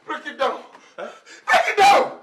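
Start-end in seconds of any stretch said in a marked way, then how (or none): 1.5-2.1 high, yelled order; shouted command. Acted. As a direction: scream it as a furious repeat order. Repeats order in rage.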